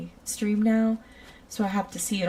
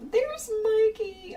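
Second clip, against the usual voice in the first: high, slow, sing-song hello; light up as a friend walks in; delighted greeting; 5 dB louder, slower than usual. Real, not acted. A streamer spots a regular viewer in chat, in a delighted high greeting.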